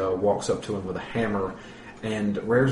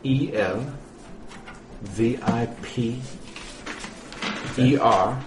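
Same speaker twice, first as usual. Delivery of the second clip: slow letter by letter; spelling it out; slower than usual. Shown as a demonstration. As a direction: spell each letter slowly. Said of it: "Can you spell that?".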